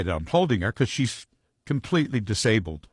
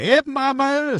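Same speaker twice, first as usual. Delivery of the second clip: high, drawn-out catcall; high falsetto "Hey, mama" catcall; slower than usual. Retold or imitated. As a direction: sing it out high like a guy yelling from a car. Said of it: "they're screaming at".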